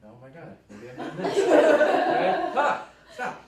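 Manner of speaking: gleeful, barely contained excitement; giddy laughing thoughts. Shown as a demonstration. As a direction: laugh at yourself for getting carried away. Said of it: "this is an example".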